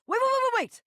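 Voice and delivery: high, rapid-fire pleading to stop; frantic interruption. Acted. Stopping the box going into the bin, frantic.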